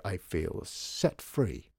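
slow, drawn-out relish; lingering on "set free", falling at the end; savoured declaration. A narrator's reading. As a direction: stretch out SET FREE as a statement. Declares his relief, savouring it.

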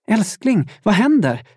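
high-pitched worried cry of a partner; anxious, urgent question; alarmed wife. A narrator's reading. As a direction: call out in alarm, pitched well up.

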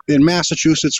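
Boston accent, R dropped. Shown as a demonstration. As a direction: say Massachusetts like a Bostonian. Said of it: "we dropped the R".

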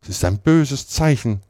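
„böses“ betont, höher; mit Nachdruck. A narrator's reading. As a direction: sag es nachdrücklich. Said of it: "mit Nachdruck". (Tante Polly warnt nachdrücklich)

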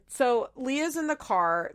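ordinary delivery

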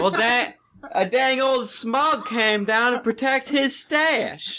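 Hank Hill Southern twang; Southern accent. Acted. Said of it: "That was really good".

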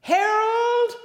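high, loud yell of a name. Retold or imitated. Wife yelling for her husband into the men's bathroom, loud high shout. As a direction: call him out sharply across the room. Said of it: "a woman yells into the bathroom".

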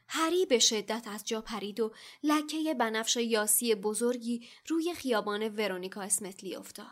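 usual speaking voice

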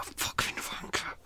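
slow whispered curse; furious whisper. Real, not acted. Vlogger cursing neighbour's lawnmower, slow whispered fury. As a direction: mutter it under the breath, seething. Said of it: "I'm really angry".

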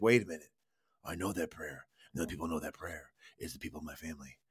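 father's voice. Retold or imitated. The father recognizing his sons' prayer, low and hushed. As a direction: drop low and quiet as the stern father. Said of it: "he's like".

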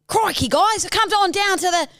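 high, excitable Aussie wildlife host; hammed-up Australian zoo showman; Steve Irwin. Contestant starts an impression of an icon, excitable Aussie showman. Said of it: "Steve Irwin".

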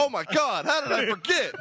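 high-pitched burst of real excitement; excited yelling. Real, not acted. Comic remembers a forgotten favorite, excited yelling. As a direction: yell it with a laugh, thrilled. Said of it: "Stop yelling at me".

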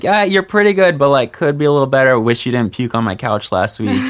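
mock friend review. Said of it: "It's like".

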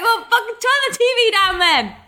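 high, laughing outburst; hammed-up fed-up daughter; mock exasperated retort. Acted retort to mum, laughing.

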